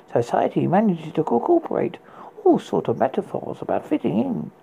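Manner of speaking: normal talking voice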